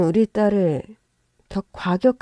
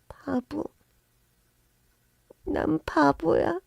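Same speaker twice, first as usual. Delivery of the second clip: soft, high, slow murmur; dazed self-blame; 7 semitones higher, 6 dB quieter, slower than usual. Acted. Daughter admitting she's a fool, slow soft murmur. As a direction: say it quietly, almost to herself.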